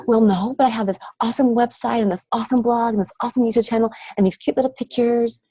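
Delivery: high mock voice of a coach listing her awesome stuff; lightly hammed-up complaining coach. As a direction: play the frustrated coach who misses the point. Voicing the same complaining coach.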